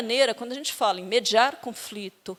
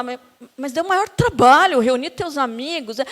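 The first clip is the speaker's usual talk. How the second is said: mock complaining woman; 2 semitones higher, 5 dB louder than usual. Wife reproaching husband over gathering friends, mock whiny complaint. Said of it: "E ela vai falar".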